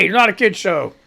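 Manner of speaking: ordinary talk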